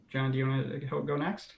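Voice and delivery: ordinary delivery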